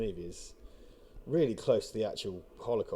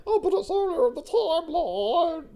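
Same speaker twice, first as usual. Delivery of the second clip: high, slow sneering mock voice; mocking whiny fan; 6 dB louder, slower than usual. Mocking a fan who excuses the plot, high and slow. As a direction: mimic the whiny excuse, laughing.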